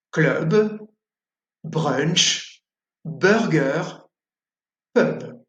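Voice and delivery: "Club", "brunch", "burger", "pub" with the U as in "sœur"; slow model words with the French U; French U sound. Shown as a demonstration. Teacher modelling words for students to repeat. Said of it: "À vous maintenant avec les mots".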